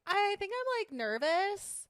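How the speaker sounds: high, slow hesitant little voice; high naive voice. Retold or imitated. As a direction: play it innocent and unsure. Retelling her own answer.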